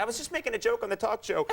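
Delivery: high-pitched, hammed-up wounded protest; mock sad guy. Host plays himself turning up uninvited, mock-hurt high voice. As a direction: climb into a pretend pleading whine. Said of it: "I'm the kind of sad guy that will show up".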